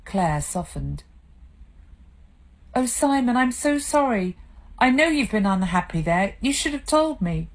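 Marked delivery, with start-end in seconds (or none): 2.7-7.5 higher, gently consoling; sympathetic. Acted. Wife comforting her tearful husband, gently. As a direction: soothe a crying husband.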